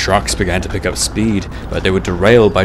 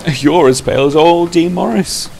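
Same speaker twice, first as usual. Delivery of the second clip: high, loud workman voice; lively high-voiced ribbing; teasing workman; 10 semitones higher, 6 dB louder than usual. A narrator's reading. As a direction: tease him in a raised, lively pitch. Workman teases pale Bear.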